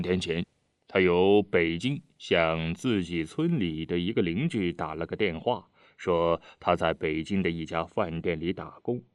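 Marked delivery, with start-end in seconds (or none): none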